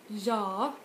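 "ja" exaggerated in two syllables, first one stressed. Shown as a demonstration. Teacher exaggerating a yes reply, stress up front. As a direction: hit the first half of a drawn-out "ja". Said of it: "you stress the first one".

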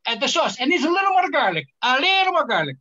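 sing-song nonna stirring the pot; Italian cook voice. Retold or imitated. Newborn Italian baby demanding garlic, hammed-up high cook voice. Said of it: "I'm gonna ram that home".

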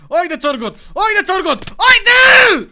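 cheers climbing to a high scream; urging himself on. Real, not acted. Kendini gaza getiriyor. (giderek tizleşen gaz verme bağırışı)

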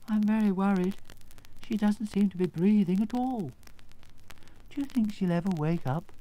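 mild worry, voice lifted; worried Scarecrow. Acted. Scarecrow fearing Dorothy won't wake, worried.

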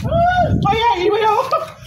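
high, slow, laughing shout; excited shout. Real, not acted. Excited teasing.

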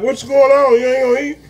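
high hammed-up what's going on; mock surprised quote. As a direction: act out shocked disbelief. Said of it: "I was like".

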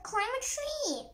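high-pitched urgent call; yell. A narrator's reading. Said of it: "yelled Chip".

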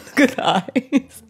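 loud, slow, teary laugh; cry-laugh at the sight; laughing shock. Real, not acted. Shocked at the filtered look.

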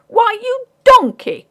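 very high, loud, drawn-out outburst; indignant cry. A narrator's reading. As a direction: explode with affronted indignation. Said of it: "cried Ray in considerable indignation".

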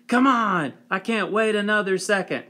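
can't wait, push them to hurry; impatient eagerness. Acted. Impatient neighbor.

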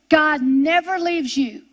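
normal talking voice

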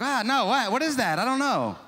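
high, loud mock panic; flustered confusion. Preacher acting out his confusion over TikTok, high loud mock panic. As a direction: throw up your hands at TikTok, overwhelmed. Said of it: "TikTok, I'm like".

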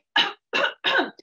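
loud, high hacking cough; choking cough. Acted. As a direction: cough like fruit is stuck in your throat. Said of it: "and he started saying".

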